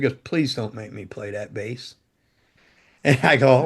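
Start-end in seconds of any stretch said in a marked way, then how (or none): 0.2-1.9 much softer, lower imitated begging; mock hushed plea to the guitar tech; quiet pleading. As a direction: plead under your breath, retelling a friend. Bassist begging not to play.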